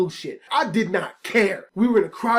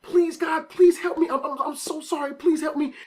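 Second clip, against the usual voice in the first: high-pitched, stammering plea; acted-out frantic pleading; desperate begging; 6 semitones higher than usual. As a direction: beg in a rush of repeated apologies. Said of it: "I am begging this woman".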